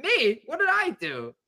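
high-pitched protest of innocence; squeaky bewildered question; baffled innocence. Retold or imitated. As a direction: retell being wrongly blamed, voice way up.

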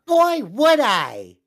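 put-on high, slow, eager little boy; gee-whiz kid answering, drawn out; wholesome 1950s kid. Kid voice from training video.

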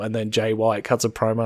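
usual speaking voice

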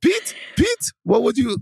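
put-on very high comic Netherlands accent; mock Netherlands accent. Repeating the joke about the Netherlands, mock Netherlands accent. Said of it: "doing the Netherlands voice".

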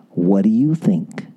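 slow, low, simple question; gentle, open invitation; very simply. Shown as a demonstration. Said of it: "I do very simply".

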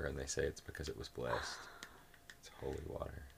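spooky hushed whisper; breathy secret whisper. Acted. Spooky whisper about holy water.

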